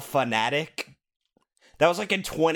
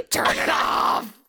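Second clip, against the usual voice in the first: slow, strained mock scream; imagined screaming; slower than usual. Picturing co-host suffering through Balan Wonderworld, hammed-up screaming. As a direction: stretch it into a hammed-up agonized scream. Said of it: "Like, screaming".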